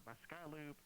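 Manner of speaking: ordinary delivery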